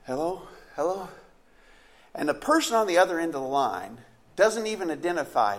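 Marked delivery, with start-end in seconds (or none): 0.0-1.1 softer, drowsy "Hello, hello?"; just woken by the phone, a sleepy hello. Acted. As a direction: answer the phone half asleep. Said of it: "with a sleepy voice you'd say".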